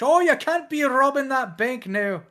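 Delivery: put-on high Irish-accented Batman; mock Irish Batman. Irish Batman turning down a would-be Robin, high. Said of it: "I don't know why he's Irish".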